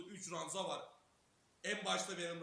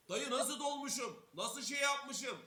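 worked up; 7 semitones higher, 6 dB louder, slower than usual. Retold or imitated. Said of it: "hem ağlıyorum".

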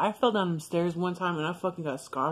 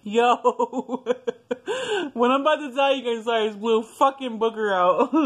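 high, louder voice breaking up with laughter; laughing, embarrassed; 7 semitones higher, 5 dB louder than usual. Real, not acted. Speaker confessing an embarrassing childhood secret to viewers, laughing hard. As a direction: talk through a real embarrassed laughing fit. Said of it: "I'm laughing because".

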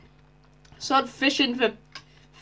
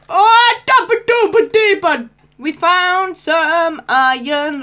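high, loud gleeful yelp at finding iron; excited outburst; 4 semitones higher, 8 dB louder, much slower than usual. Real, not acted. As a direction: burst out in delight, then stretch it slowly.

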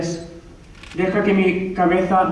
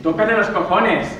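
ordinary delivery

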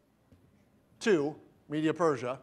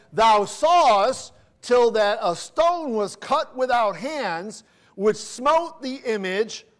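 scripture reading; 4 semitones higher, 4 dB louder, much slower than usual. Acted. Reads scripture verse.

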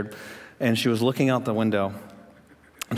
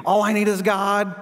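higher, slow, sing-song mock piety; mock pious slogan; 8 semitones higher, 3 dB louder, slower than usual. Preacher mocking what Christians say, high and slow. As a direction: parody the churchy slogan, drawn out. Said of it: "here's what we do".

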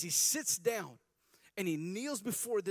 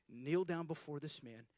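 usual speaking voice

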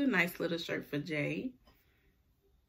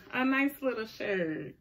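normal talking voice

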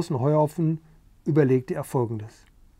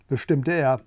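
plain reading voice

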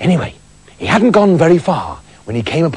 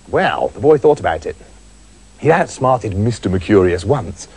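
ordinary delivery

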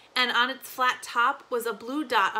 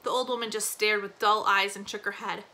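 ordinary delivery

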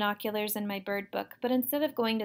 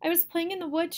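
put-on high, upset child's voice; distressed, higher-pitched account; crying complaint; 8 semitones higher than usual. A narrator's reading. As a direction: protest tearfully to a parent. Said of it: "she cried".

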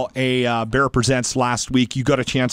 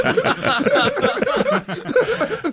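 long high burst of real laughter. Real, not acted. Radio host cracking up at the guest's beep joke.

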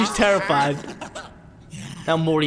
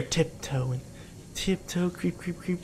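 sneaky hush; 10 dB quieter, slower than usual. Pretend. Sneaking along a narrow path.

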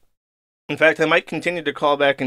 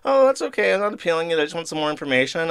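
sing-song fake cheer, leaping high on Oh; bright, overly chipper insistence; fake cheerful; 4 semitones higher, faster than usual. Needling the support rep over and over in fake cheer. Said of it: "he's like".

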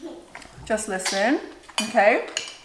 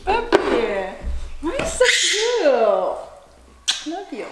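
higher, slow cooing; 4 semitones higher than usual. Real, not acted. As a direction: coo at the puppy. Cooing at the dog.

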